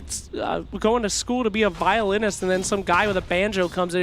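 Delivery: usual speaking voice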